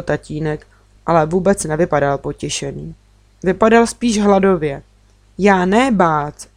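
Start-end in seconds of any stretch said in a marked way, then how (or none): none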